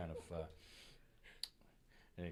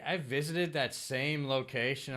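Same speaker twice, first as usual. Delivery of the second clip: ordinary talk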